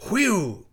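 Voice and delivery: high, falling whoop; short gusting "whee-oo"; wind howl. A narrator's reading. Snow boy howls like wind.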